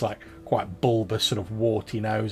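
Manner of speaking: ordinary talk, nothing marked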